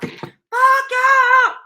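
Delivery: high, loud mock cuss-out; hammed-up angry yell at an ex; retold curse-out of an ex, high and loud; mock cursing out. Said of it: "I might have cursed you out".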